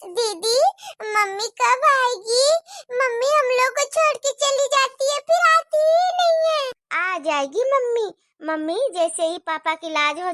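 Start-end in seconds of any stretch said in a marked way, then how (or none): 0.1-6.7 put-on complaining little girl. Child complains mother never returns.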